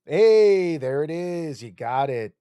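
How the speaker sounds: ordinary delivery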